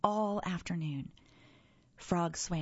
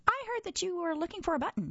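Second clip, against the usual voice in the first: much higher, fast critter voice; eager raccoon; 12 semitones higher, faster than usual. Acted. Raccoon offering Toad a button, high and fast. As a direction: rattle it off high and quick.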